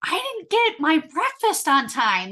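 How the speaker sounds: put-on high, loud whiny child voice; whiny five-year-old. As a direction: play a sulking little kid. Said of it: "just like a five-year-old".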